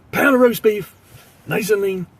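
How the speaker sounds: high, loud deli order; calling out order. Retold or imitated. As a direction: shout the order over the counter. Calls out his deli order.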